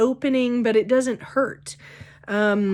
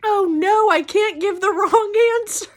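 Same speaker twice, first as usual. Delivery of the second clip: mock worry; 11 semitones higher, 4 dB louder than usual. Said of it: "in my mind, I'm like".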